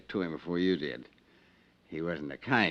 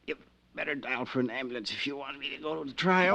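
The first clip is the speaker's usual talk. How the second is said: wounded; 11 semitones higher, 4 dB louder, faster than usual. Acted. Wounded Frank confesses he's hurt.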